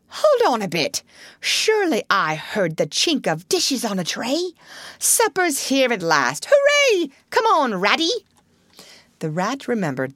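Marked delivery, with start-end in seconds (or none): 0.1-8.1 higher-pitched delight, peaking on Hooray; eager excitement. A narrator's reading. Toad hearing supper arrive, eagerly excited. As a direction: build from sudden notice to a cheer.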